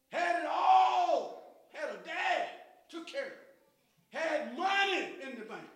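loud, high-pitched rising sermon shout; full-throated gospel preaching; preaching at full force. Acted. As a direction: whip the congregation up with the message. Prodigal son preached loudly.

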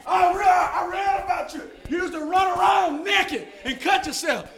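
loud, high shout across heaven; calling out. Pretend. Preacher hollering for the Gadarene in heaven, loud and high. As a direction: holler to find a man in a crowd.

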